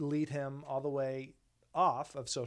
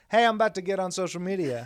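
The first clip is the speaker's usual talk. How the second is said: high, loud, fast proclamation; mocking announcer; 6 semitones higher, 10 dB louder, faster than usual. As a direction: loudly announce it like a buffoon. Mocking someone announcing it aloud.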